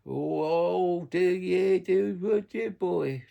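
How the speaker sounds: hammed-up posh young Romany, drawn out. Mocking youngsters who suddenly talk posh, high slow put-on voice.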